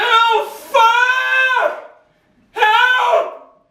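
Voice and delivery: high panicked cry for help; retold scream for help. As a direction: scream for help while hanging stuck. Retells screaming for his life.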